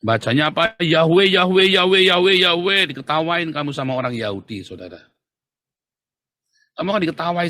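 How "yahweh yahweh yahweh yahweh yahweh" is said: loud, high-pitched parroting of a name; mocking repetition. Teacher mocks those who keep saying Yahweh, mocking repetition. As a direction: chant it back at them in mockery.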